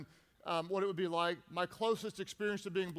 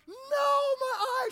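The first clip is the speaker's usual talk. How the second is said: high, loud wail of agony; hammed-up cry of pain; mock pained yell; 7 dB louder than usual. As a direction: yell it in comic panic. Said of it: "I'm screaming out".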